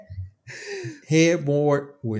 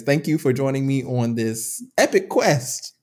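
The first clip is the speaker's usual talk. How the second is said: slow, grand announcer delivery; mock epic outro; host sign-off; 4 semitones higher, slower than usual. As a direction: wrap it up like a show host. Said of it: "show sign-off".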